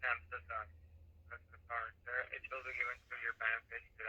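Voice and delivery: ordinary talk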